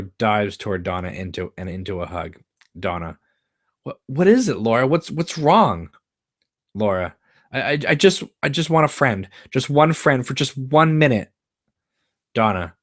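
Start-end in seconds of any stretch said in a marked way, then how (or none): 3.8-5.9 alarmed concern. Acted. Donna alarmed by Laura's state. 7.5-11.3 higher and louder, halting with stammers; a friend at the end of her rope; tearful plea. Acted. Laura breaking down to friend.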